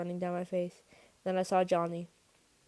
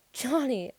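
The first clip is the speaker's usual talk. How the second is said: higher, panicked call; near scream; 6 semitones higher, 4 dB louder than usual. A narrator's reading. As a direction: cry out, just short of screaming. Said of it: "I nearly screamed".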